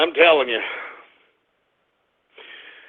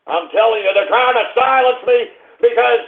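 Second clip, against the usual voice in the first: ordinary talk, nothing marked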